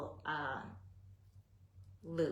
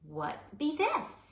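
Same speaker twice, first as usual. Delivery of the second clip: quaint mock voice; 8 semitones higher, 3 dB louder, slower than usual. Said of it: "And I'm like".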